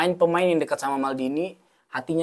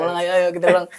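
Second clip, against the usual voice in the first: ordinary delivery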